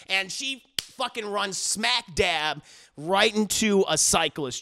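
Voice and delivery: ordinary talk, nothing marked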